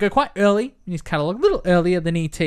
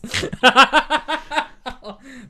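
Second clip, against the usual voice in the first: big rising laugh at his own joke; loud laugh; loud high laugh; 8 semitones higher, 5 dB louder than usual. Real, not acted. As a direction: burst out laughing.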